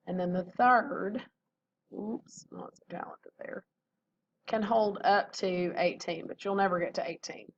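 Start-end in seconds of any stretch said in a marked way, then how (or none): 1.9-3.6 whispering to herself; under-the-breath self-mocking aside; whispered aside. Real, not acted. Self-mocking aside after a slip.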